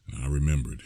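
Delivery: ordinary delivery, nothing marked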